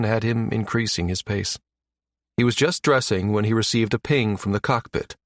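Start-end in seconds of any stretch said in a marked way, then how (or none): none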